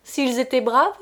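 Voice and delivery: put-on loud, scornful drunken taunt; loud mockery. A narrator's reading. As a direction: jeer at her, still laughing. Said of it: "Puis il se mit à rire".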